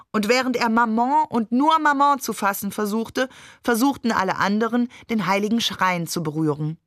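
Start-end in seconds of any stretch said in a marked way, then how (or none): none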